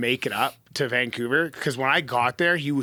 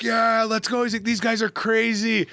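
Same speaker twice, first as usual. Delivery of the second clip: higher-pitched imitated hype man; pumped-up quote at moderate volume; hyped cheer; 7 semitones higher than usual. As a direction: retell the singer's excited cheer. Singer cheers him on, retold.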